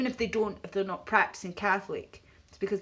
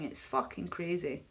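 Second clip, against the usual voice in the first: usual speaking voice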